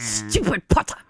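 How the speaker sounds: very low mock-villain voice; Draco Malfoy. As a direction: drop low for a scornful villain impression. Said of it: "Do Draco Malfoy".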